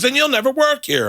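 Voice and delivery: high-pitched mock angry threat; retold threat. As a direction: imitate a booker making a furious threat. Retold booker threatens comedian.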